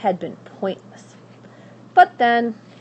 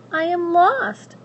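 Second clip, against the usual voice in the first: put-on high, pleading young princess; 10 semitones higher, 6 dB louder, slower than usual. Princess begging at the witch's door, high and pleading.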